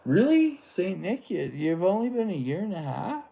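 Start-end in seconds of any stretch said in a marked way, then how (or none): 0.0-0.6 shoots way up, loud disbelief; a real jolt of astonishment at the chat; loud, much higher stunned "Really?"; surprised. Real, not acted. Surprised reply to chat message.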